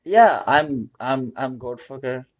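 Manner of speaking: usual speaking voice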